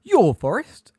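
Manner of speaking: high, loud, outraged question; indignant, shaking. A narrator's reading. Umbridge disputing the centaurs' forest, indignant and frightened. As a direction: echo their claim with indignation. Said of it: "shaking now, not only with fright, but also, it seemed, with indignation".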